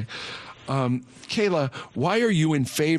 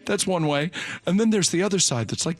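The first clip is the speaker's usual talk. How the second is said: normal talking voice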